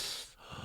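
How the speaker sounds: sharp voiceless intake of breath; sudden sucked-in gasp, acted out; imitated gasp. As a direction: gasp as if bracing for a near crash. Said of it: "I remember being like".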